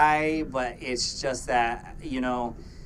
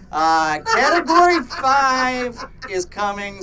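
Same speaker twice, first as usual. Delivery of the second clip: excited hype; 11 semitones higher, 10 dB louder, slower than usual. Real, not acted. Hyping the hurricane on stream.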